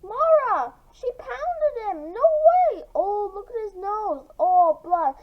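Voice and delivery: high, loud classroom uproar; excited yelling. Acted. Classmates shouting after seeing Greg's bloody nose. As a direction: shriek with shocked glee.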